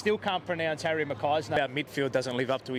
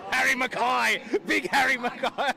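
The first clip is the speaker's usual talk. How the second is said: shouted greeting, high and loud; excited shout at a fan; calling out; 8 semitones higher, 4 dB louder than usual. Real, not acted. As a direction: hail a mate across the mall.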